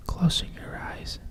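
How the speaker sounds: ordinary delivery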